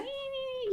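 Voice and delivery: very high, soft "ee" squeal; high pet-talk squeal. Shown as a demonstration. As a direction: squeak softly as if to a dog. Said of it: "talk to her in that voice".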